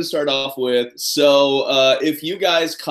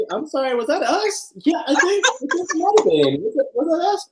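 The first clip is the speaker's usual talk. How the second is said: giggly mock innocence; 13 semitones higher than usual. High laughing mock innocence.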